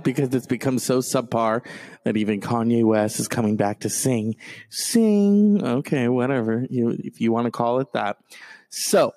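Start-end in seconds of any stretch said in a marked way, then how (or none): 4.7-5.6 mocking. Real, not acted. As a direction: scoff at the word. Mocking [name] singing.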